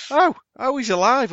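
high-pitched, dawning mock realisation; acted-out "oh!" of surprise; imitated surprise. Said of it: "he's like".

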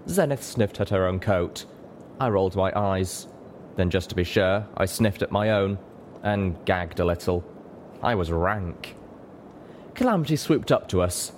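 usual speaking voice

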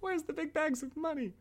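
very high, soft squeak; kid's voice. Acted. Kid asking the mother at the door, tiny squeaky voice. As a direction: squeak it quietly as a little kid. Playing kid demanding money.

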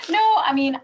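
laughing high, then speaking; a big laugh breaking into words. Real, not acted. Laughing at the formal question.

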